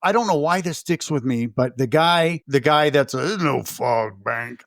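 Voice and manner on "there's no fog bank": imitating the sailor, lower and slow; low, slow mimicry of the film's sailor; sailor's voice. Podcaster mimicking the film's sailor for his cohost, low and slow. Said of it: "That's how he talks".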